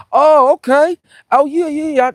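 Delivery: high, loud "oh" of realization; sudden recognition. Real, not acted. Streamer recalling a chat about a birthday gift, sudden recognition. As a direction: it all clicks, pitch leaping up. Suddenly understands what viewer means.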